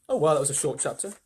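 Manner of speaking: usual speaking voice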